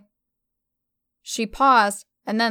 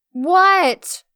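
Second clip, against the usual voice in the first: high, loud annoyed screech; half screech; 7 semitones higher, 6 dB louder than usual. A narrator's reading. Emma whips round and screeches at Stella. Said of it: "I half screeched, half grumbled".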